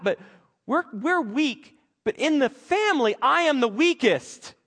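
high, loud, anxious pleading; panicked Gideon. Acted. Preacher voicing Gideon's panic at God's call, high and loud.

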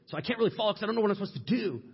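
high, fast, mock-frustrated protest; exasperated complaint. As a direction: rush it out like a confused follower complaining. Acted complaint of confused follower.

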